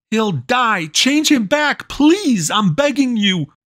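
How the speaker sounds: high, desperate pleading; a boy begging the witch to spare his friend; frantic begging. A narrator's reading. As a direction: beg for his life.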